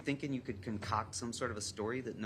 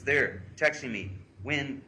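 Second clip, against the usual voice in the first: loud, higher reading of a plea; insistent, raised-voice read-aloud; urgent pleading; 4 semitones higher, 9 dB louder than usual. Retold or imitated. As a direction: read her plea out with force. Reads girl's pleading texts aloud.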